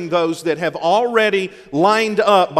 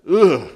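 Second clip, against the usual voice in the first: ugh of disgust; 7 dB louder than usual. Real, not acted. Disgust at sugar on grits.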